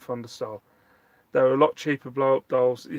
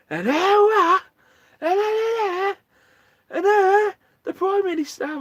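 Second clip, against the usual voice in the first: loud, very high put-on voice; high-pitched, drawn-out hammed-up announcement; mock announcer; 7 dB louder than usual. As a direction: squawk it out far above your own pitch.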